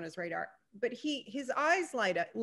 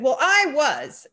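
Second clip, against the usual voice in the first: louder, slow, pointed retort; indignant retort; 4 semitones higher, 11 dB louder, slower than usual. Retold or imitated. The woman snaps back at the narrator, louder and slow. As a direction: shut the other person down, word by word.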